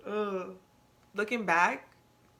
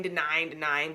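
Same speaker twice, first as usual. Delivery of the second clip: ordinary talk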